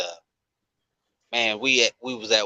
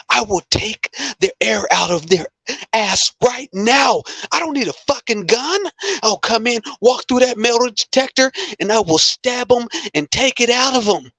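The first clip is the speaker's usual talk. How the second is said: much higher, louder, near-crying furious shout; imitating an enraged breakdown; 13 semitones higher, 4 dB louder than usual. Veteran breaking down at work over his military blade, imitated enraged crying shout. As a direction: act out a shaken veteran losing control.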